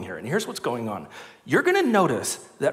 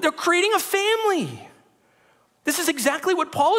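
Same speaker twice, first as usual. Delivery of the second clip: full-force preaching; 12 semitones higher than usual. Acted.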